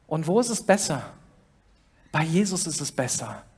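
usual speaking voice

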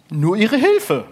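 ordinary talk

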